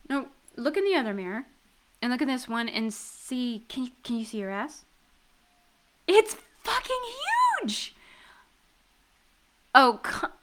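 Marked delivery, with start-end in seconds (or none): 6.1-7.9 high squeak dropping to a hush; amazed exclamation. Acted. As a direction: squeal then whisper in awe. Reacting to his huge behind.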